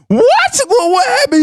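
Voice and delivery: high, stunned retold disbelief; mock-startled what, what happened; shocked what. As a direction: replay the moment he couldn't believe it. Retold shock at being arrested.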